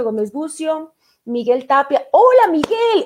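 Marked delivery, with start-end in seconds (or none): 2.1-3.0 high, loud, delighted hello; excited greeting. Real, not acted. Radio host spots her ex-colleague online, excited shout.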